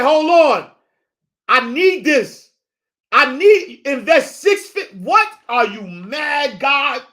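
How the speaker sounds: high, loud disbelief; loud, indignant "are you mad, God"; indignant protest. Retold or imitated. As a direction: argue back at God, incredulous.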